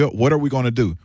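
normal talking voice

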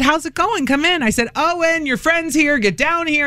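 a hint of sing-song mom calling her son; lightly voiced cheerful mom.

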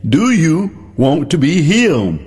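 slow, drawn-out gentle question; drawn-out question with a hint of Jesus' voice. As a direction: lay each word out slowly, like Jesus asking.

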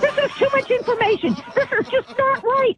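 high-pitched real indignation; real exasperated outburst. Real, not acted. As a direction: protest in disbelief, voice pushed up high. Mother refusing to hear more.

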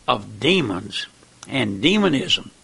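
ordinary talk

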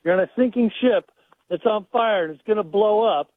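normal talking voice

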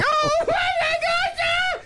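very high, slow, imitated panic; the wife shrieking at the dog; mock panicked scream. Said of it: "she's screaming and like".

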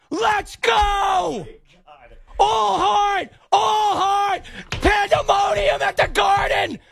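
screaming at the top of a high, cracking voice; ecstatic shouting. Real, not acted. Host erupting on air as the Knicks win, ecstatic shouting. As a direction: lose it completely as the winning tip drops.

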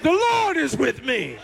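The Lord is with me declared with conviction. Shown as a demonstration. Congregation urged to believe, said with conviction. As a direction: say it like you believe it. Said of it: "say it like you believe it".

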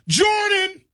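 high-pitched shout of a name; shooter's call-out. Acted. Office shooter calling a great's name, shouted high. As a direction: yell it like calling your shot.

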